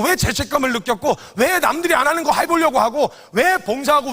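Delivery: ordinary delivery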